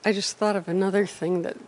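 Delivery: soft, hard to hear; barely audible mumble; too quiet. Real, not acted. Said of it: "Speak up, please".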